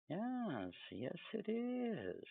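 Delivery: usual speaking voice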